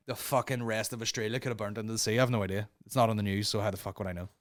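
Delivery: ordinary talk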